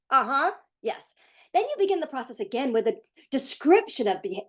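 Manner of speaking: usual speaking voice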